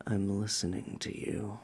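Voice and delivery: low, hushed eerie voice; creepy voice. Pretend. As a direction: spook the caller, low and soft. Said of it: "Why do you sound so creepy?".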